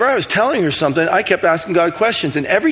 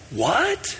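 baffled what; 4 semitones higher, 7 dB quieter than usual. Shown as a demonstration. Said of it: "my usual response when God speaks to me is this".

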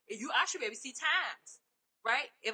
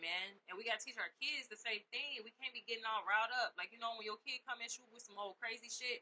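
ordinary delivery

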